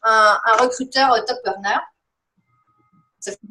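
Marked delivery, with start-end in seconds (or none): 1.3-1.8 English accent. Shown as a demonstration. A French coach asks how to become a top earner, in an English accent. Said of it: "un pauvre accent anglais".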